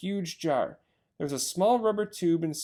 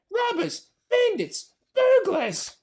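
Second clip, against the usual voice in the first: high squeaky shrieks, louder each time; Rat shrieking; 6 dB louder, slower than usual. Acted. Rat shrieks after the escaping foxes, high and building. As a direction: yell after them in outrage. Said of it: "shrieked Rat".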